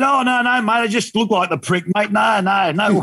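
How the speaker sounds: high, hurried-sounding protest; mock anxious denial. Passenger denying his identity to second driver, mock worried denial. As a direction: act out denying it for comic effect.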